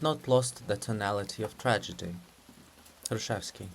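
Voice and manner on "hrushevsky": ordinary delivery, nothing marked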